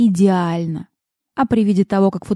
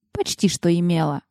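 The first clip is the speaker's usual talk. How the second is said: ordinary delivery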